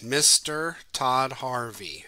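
"Mister Todd Harvey" slow, each syllable its own beat; clapping syllables. Shown as a demonstration. Teacher claps out the haiku's syllables, slow and even. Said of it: "if you clap out the syllables".

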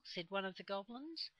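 ordinary delivery, nothing marked

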